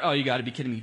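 ordinary delivery

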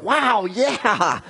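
the king half-joking at the bad news; mock dismay. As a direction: play Hezekiah half-joking that it's bad. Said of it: "He's like".

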